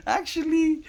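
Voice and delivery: actually jumps up into a high squeak; squeaky realization. Retold or imitated. Squeaky high realization as she imitates her own thinking to viewers. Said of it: "you kind of start thinking, like".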